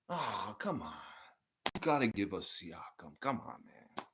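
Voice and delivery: soft, deflated mock Philly pleading; Random Gamer with Philly accent. Fan begging the front office for Siakam, soft mock Philly accent. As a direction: plead under your breath as a silly Philly fan. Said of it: "do an impression of you with a strong Philly accent".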